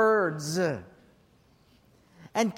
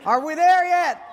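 put-on much higher and far louder kid's yell; shrieking kid; 7 semitones higher, 8 dB louder than usual. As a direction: yell it like a kid at the end of patience. Kids nagging, loudest.

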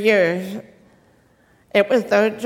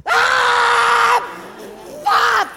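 garbled shout; 14 semitones higher than usual. Retold or imitated. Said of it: "I said something like".

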